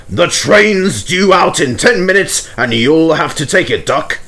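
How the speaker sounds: Fat Controller. Acted. Fat Controller telling Duck to take the train, bossy.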